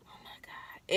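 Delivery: breathless whisper; hushed shock at the gush; whispered oh my God. Retold or imitated. Feeling her water break, shocked whisper. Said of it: "So I'm just like".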